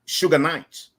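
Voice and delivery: ordinary talk, nothing marked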